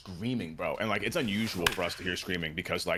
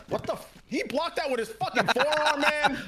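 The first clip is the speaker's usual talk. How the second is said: streamer rage yell. Retold or imitated. Streamer showing police how he rages, high yelling. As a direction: yell it like a bad call just robbed you. Reenacting his streamer screaming at game.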